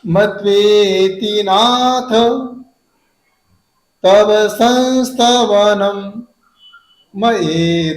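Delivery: मत्वेति नाथ, pause, तव संस्तवनम, pause, मयेद, slow; pauses in three places. Shown as a demonstration. Teacher reciting with three clear stops. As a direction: break the line into three slow parts. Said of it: "तीन जगह आपको stop देना है".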